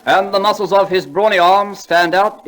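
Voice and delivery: slow, lofty recitation; poem recital. Acted. [name] reciting Longfellow to the audience, slowly and grandly. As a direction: declaim each line like a school prize reciter.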